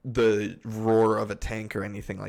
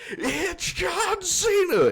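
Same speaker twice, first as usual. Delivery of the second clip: very high, drawn-out mock holler; announcer-style meme shout; 3 dB louder, much slower than usual. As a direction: stretch it into a high-pitched meme yell. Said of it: "all I hear is".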